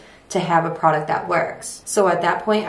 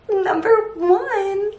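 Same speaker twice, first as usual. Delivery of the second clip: high sing-song; 4 dB louder, much slower than usual. Acted. High stretched emphasis.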